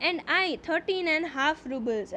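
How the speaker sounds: slow, deliberate reading aloud of the English text; slow story reading. A narrator's reading. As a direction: read each phrase slowly, as a teacher before translating. (ધીમે ધીમે વાર્તાનું વાંચન)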